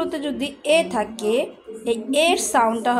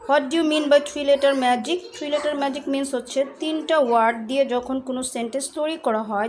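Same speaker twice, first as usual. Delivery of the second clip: ordinary delivery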